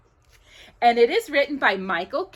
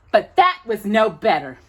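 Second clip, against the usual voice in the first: usual speaking voice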